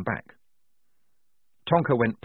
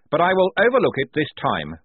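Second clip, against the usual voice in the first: higher, louder formal address; officious lieutenant; 7 semitones higher, 6 dB louder than usual. Acted. Blouse addressing the confused squad, officious. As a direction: announce it primly, pitch lifted.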